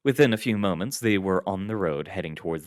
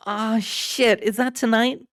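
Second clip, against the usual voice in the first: high, louder exasperated groan; 6 dB louder, slower than usual. A narrator's reading. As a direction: Adams realizing she forgot. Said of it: "Adams groaned".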